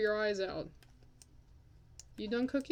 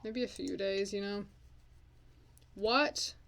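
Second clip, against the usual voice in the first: ordinary talk, nothing marked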